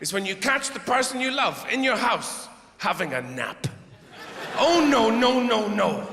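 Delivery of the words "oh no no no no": hammed-up alarm that fades; mock horrified no. Partner caught napping, in a loud mock horrified no.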